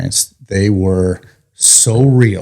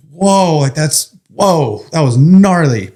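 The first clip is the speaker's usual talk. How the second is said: high, stunned whoa; reeling from a nightmare, voice pitched up; shaken amazement; 6 semitones higher, slower than usual. Retold or imitated.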